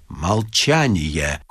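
slow, low and cold; a low, drawn-out cold command. A narrator's reading. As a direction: shut him down icily with one slow word. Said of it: "холодно сказал Остап".

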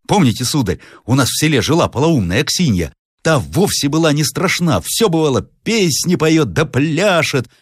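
high-pitched servant telling village tales; болтливый слуга. Acted. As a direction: recount it to the master in lively high tones. Servant tells about madwoman. (слуга рассказывает высоким голосом)

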